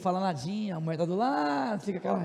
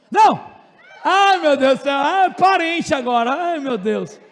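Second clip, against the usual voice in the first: loud high shocked cry then anguished groan; shocked outcry; 4 semitones higher, 9 dB louder than usual. Real, not acted. To the class, after learning wife is a relative: loud shocked outcry. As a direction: cry out in mock horror at the news.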